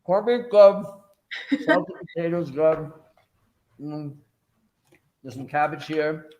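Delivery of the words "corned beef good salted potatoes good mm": talking through a mouthful of food; chewing while speaking; mouth full. Real, not acted. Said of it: "I'm talking in my mouth".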